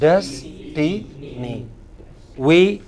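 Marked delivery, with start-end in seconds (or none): 0.0-1.7 each syllable said separately with pauses; spelled out in slow, separate parts. Shown as a demonstration. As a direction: break it into "Des", "ti", "ni". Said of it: "It has three parts. What are those parts?".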